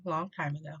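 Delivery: plain reading voice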